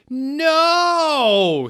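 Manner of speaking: very high, loud shout. Acted. Dumbledore shouting as he looks at Snape and Lupin. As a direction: cut him off with a shout. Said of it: "he shouted".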